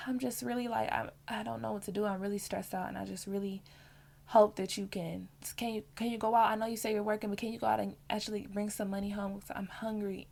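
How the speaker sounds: loving wifely plea. Retold or imitated. Asking her husband for money, soft and loving. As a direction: coax him gently, like a caring wife. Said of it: "I say it in a loving, caring, wifely way".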